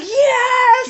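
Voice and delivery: high, loud, hammed-up victory shout; acted jubilant yell; triumphant cheer. Acts out celebrating the plea.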